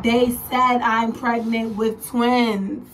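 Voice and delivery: slow, loud, spelled-out announcement; loud emphatic repeat. Retold or imitated. Woman repeating news to inattentive partner, slow and loud. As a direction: drive each word home to someone not listening. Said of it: "He didn't even really, like, pay it no mind".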